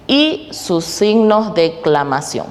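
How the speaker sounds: usual speaking voice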